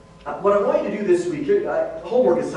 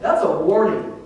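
ordinary delivery

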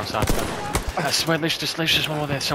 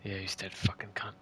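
whispering; 4 semitones lower, 10 dB quieter than usual. Real, not acted. Muttering after a kill.

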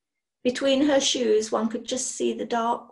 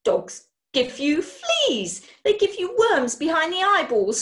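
screeching; 7 semitones higher, 5 dB louder than usual. A narrator's reading. Mother screeches disgust at the bulldog, loud. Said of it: "screeched Missus Boothroyd".

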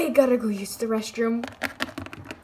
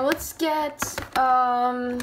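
ordinary delivery

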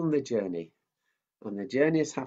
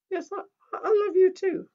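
much higher squeaky puppet; 9 dB louder than usual. Acted. Mickey the puppet cutting in, high-pitched. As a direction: make the puppet pipe up.

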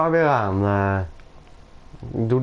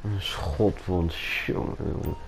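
soft, slow whispered sigh; exasperated whisper; 6 semitones lower, 9 dB quieter, slower than usual. Acted. Uncle hearing the nephew got shot, slow weary whisper. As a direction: breathe it out, slow and hushed. Uncle hears of gunshot wound.

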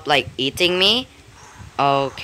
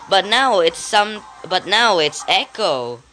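normal talking voice

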